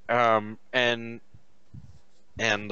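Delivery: usual speaking voice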